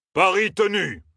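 high-pitched sudden call; abrupt cry, pitch leaping up. A narrator's reading. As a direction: cry out acceptance of the bet. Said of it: "cria soudain Ammar".

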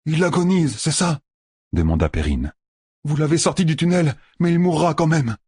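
voix de jeune seigneur plus aiguë; Perrine inquiet. A narrator's reading. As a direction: demander la vérité, inquiet. Perrine questionne. (Perrine interroge sur l'état de Rand)

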